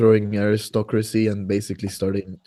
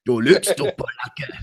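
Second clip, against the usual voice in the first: mock accusing soldier; 3 semitones higher than usual. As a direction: snarl it then drop to a whisper.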